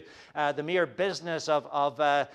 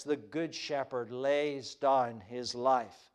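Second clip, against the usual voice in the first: hushed solemn quote; 6 semitones lower, 7 dB quieter, slower than usual. Shown as a demonstration. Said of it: "Jesus says".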